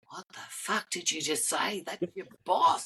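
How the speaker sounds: hushed disbelieving self-talk, slowing at the end; talking to himself in disbelief after the boss walks off; mock shocked aside. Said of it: "I went".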